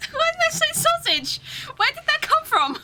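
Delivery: very high, loud squeal of laughing disbelief; crying with laughter. Real, not acted. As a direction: wail it helplessly through tears of laughter. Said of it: "Oh God, I'm crying".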